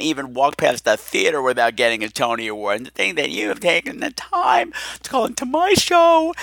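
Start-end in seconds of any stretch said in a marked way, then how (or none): 2.8-6.3 shrieking with fan excitement; starstruck squeal. Real, not acted. Starstruck by celebrity call.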